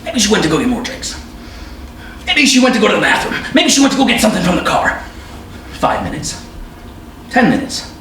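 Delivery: searching the bar for his wife, building panic; rising panic. Acted. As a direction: spiral from calm guessing into frantic.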